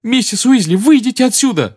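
high, loud urgent shout. Acted. Harry seeing his own body, shouting at Mrs Weasley. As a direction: yell at her to get out. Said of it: "закричал Гарри".

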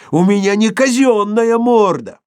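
very high, loud squeal; shrieking. A narrator's reading. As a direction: keep squealing in outrage. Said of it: "взвизгнул Шариков".

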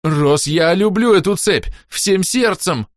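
slow, high, loud desperate begging; panicked plea. A narrator's reading. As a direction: plead for the chain, terrified. Whisper pleading in panic.

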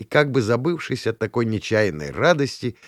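plain reading voice

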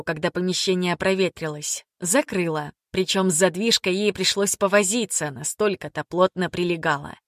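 ordinary delivery, nothing marked

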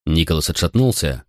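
ordinary delivery, nothing marked

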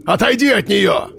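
high booming order; commanding shout. A narrator's reading. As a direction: order him away. Armed man orders.

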